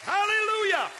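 loud, high, climbing preacher's cry; full-force preaching. Acted. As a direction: build the blessing to a roaring peak.

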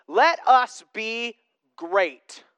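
slow emphatic. Retold or imitated. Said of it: "And they respond".

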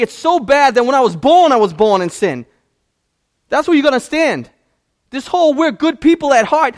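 loud, high, driving preaching; preaching at full force. Real, not acted. Pastor hammering the congregation, full-force preaching. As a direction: preach it hard to the room.

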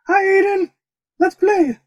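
high squeaky talking dog. Pretend. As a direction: talk like a playful puppy. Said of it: "What if it was saying".

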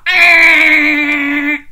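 put-on a loud, toothy "Ah" snarl for the children; bare your teeth and roar it out; sharp-teeth growl. Shown as a demonstration. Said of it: "show me your sharp teeth and go".

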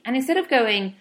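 normal talking voice

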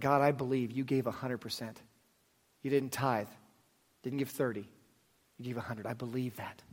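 soft, hushed prayer voice; quiet prayer. Acted. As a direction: pray it softly and sincerely. Leads a prayer.

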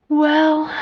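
weary narrated sigh. A narrator's reading. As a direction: sigh out a resigned word. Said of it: "sighed her father".

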